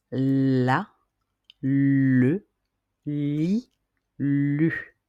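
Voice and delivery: la, le, li, lu each clearly articulated with pauses; syllables la le li lu. Shown as a demonstration. Teacher models la le li lu for pupils to repeat. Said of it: "vous allez répéter après moi".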